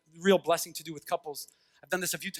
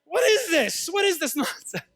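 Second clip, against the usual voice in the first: incredulous; 9 semitones higher, 4 dB louder than usual. Acted. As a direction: exclaim it high, baffled and amused. Mocking the show's absurd dates.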